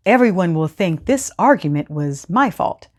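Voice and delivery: ordinary talk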